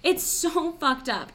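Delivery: high, pained plea to dad; upset disbelief. Retold or imitated. Retelling her upset reply to dad.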